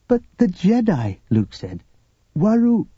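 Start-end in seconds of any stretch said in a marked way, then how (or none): 0.1-1.2 high-pitched, urgent protest; protesting Luke. A narrator's reading. Figure voice of Luke. 2.4-2.9 high-pitched, pleading insistence; insistent Luke. A narrator's reading. Figure voice of Luke.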